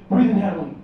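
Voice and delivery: normal talking voice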